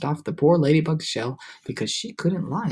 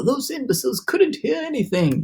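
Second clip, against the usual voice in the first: cried; 9 semitones higher than usual. Acted. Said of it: "he cried".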